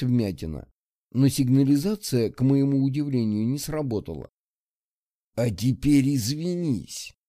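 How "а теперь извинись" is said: slow, menacing hiss; hissed threat. Acted. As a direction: hiss it through the teeth. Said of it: "прошипел он".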